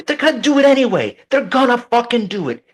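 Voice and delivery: high, loud, exasperated outburst; heated rant. Real, not acted. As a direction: vent it, pitch rising with anger. Heated rant about politicians.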